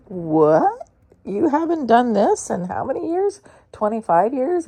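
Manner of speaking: loud, high disbelieving question; incredulous dentist. Retold or imitated. Dentist staring at the patient, loud and incredulous. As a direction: mimic the dentist's shocked disbelief. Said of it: "he looked at me like".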